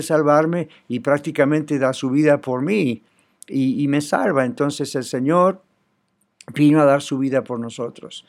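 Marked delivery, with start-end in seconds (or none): none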